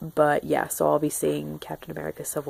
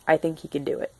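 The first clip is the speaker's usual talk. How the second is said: ordinary talk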